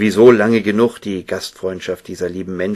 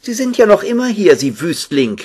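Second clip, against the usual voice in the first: very high, louder scolding woman; unfriendly; 12 semitones higher, 7 dB louder than usual. A narrator's reading. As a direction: greet an unwelcome visitor in a high, hostile voice. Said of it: "sagte Cora Beth unfreundlich". (unfreundlich keifend, hoch)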